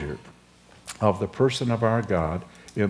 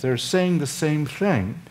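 ordinary talk

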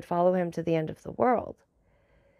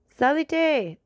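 higher, louder order to a servant; commanding; 7 semitones higher, 5 dB louder than usual. A narrator's reading. The Count orders the messenger up, commanding. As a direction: call it out as a firm order. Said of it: "in the same tone in which he would have given an order".

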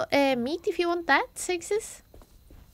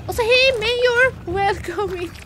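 cheery greeting; 7 semitones higher, 8 dB louder than usual. Real, not acted. Greeting NPC loudly.

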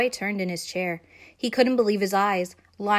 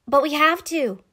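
insistent; 7 semitones higher, 7 dB louder than usual. A narrator's reading. Insistent girl.

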